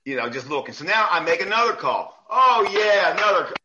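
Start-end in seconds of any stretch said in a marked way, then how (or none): 2.3-3.5 higher, louder mock praise; loud, high sarcastic jeer; sarcastic taunt. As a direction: jeer at the call. Coach taunts ref again.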